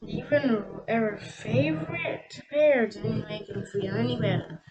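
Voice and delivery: ordinary delivery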